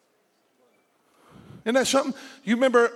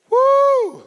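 high shouted woo; excited whoop; 9 dB louder than usual. Real, not acted. Preacher whooping after the congregation answers. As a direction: whoop with joy.

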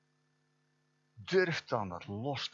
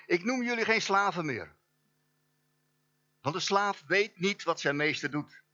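higher, louder proclaiming voice; voice of Jesus; 9 semitones higher, 6 dB louder than usual. Acted. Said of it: "Jezus aan het woord".

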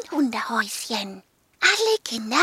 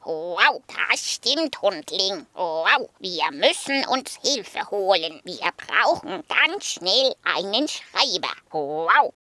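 lower, woofing dog voice; growling dog; 5 semitones lower than usual. Acted. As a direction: woof it like a big dog. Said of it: "Sokrates nickte".

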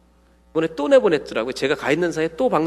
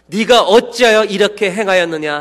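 slow loud rebuke; 6 semitones higher, 8 dB louder than usual. Acted. Congregation reads sailors' rebuke.

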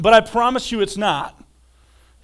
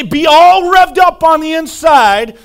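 mock revved-up; 3 semitones higher, 7 dB louder than usual. Shown as a demonstration.

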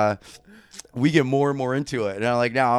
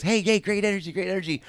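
imitated assistant director, pitched up to rein people in; 11 semitones higher, faster than usual. Assistant director calming the rowdy extras, placating.

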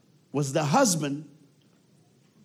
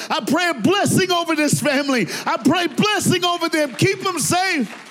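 over-the-top forgiveness; 12 semitones higher, 8 dB louder than usual. Retold or imitated. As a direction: bless her like a preacher at full force. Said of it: "he's over the top".

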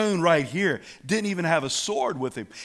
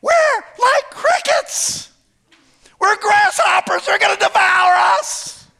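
very high, loud squeal; hammed-up panicky shriek; mock terror; 8 dB louder than usual. Scared scouts reporting giants, hammed-up high shriek. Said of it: "These ten are like".

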